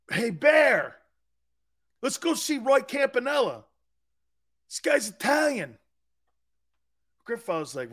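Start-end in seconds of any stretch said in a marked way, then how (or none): 0.1-5.8 high-pitched, eager retold invitation; excited calling out. Pals inviting grandfather to the ballgame, excited calling out. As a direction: pitch it up like selling a friend on it.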